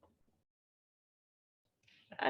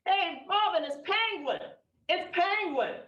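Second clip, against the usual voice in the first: higher-pitched retold call to a husband; calling to someone; 6 semitones higher than usual. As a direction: call over to him with raised pitch.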